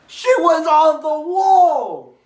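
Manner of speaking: high, loud, stretched-out holler; real excited whoop; hyped shout. Real, not acted. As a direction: yell it slowly at the top of your voice. Hyped fan exclaiming about a moment.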